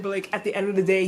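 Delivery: usual speaking voice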